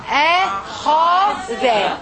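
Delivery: "أخذ" very slowly in three drawn-out syllables. Shown as a demonstration. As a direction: stretch "أخذ" into a, kha, dha. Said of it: "pronounce with me this one".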